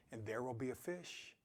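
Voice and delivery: rereading the Ovid quote to listeners, hushed ending. Acted. Repeating Ovid quote, hushed end.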